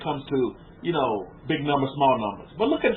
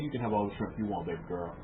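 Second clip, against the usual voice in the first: tender reassurance; 7 semitones lower, 9 dB quieter, faster than usual. Retold or imitated. Father reassuring his daughter.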